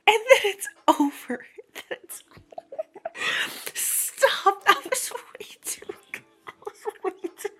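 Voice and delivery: high-pitched, breaking into laughter mid-word; real helpless laughter. Real, not acted. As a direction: try to talk while cracking up. Cracking up at the abrupt ending.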